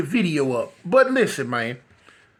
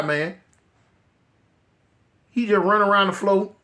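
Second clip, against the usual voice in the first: normal talking voice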